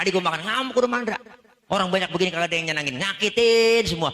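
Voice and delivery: mock rant. Comic retelling of Mandra's rage.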